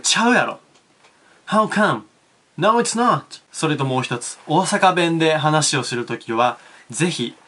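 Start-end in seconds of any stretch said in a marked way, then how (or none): 0.0-3.4 straight man's retort. Shown as a demonstration. Straight person pointing out the mistake, tsukkomi retort. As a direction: correct the funny guy sharply. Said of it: "As a straight person, you point out the mistake".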